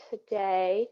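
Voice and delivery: ordinary talk, nothing marked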